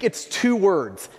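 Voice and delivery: ordinary talk, nothing marked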